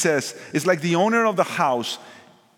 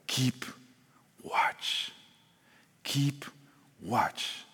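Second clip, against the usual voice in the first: hushed urging; 8 dB quieter, much slower than usual. Acted. Preacher hushing the church to keep watch, whispered. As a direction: breathe the words like a secret warning. Preacher urges quiet vigilance.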